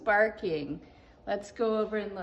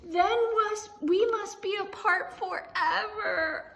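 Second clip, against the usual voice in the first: tearful cry; 8 semitones higher, 5 dB louder than usual. Acted. Gerald's sad farewell.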